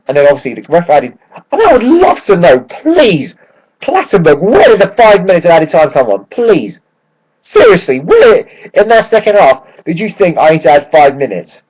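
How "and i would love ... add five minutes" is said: exasperated plea. Real, not acted. Pleading with the referee.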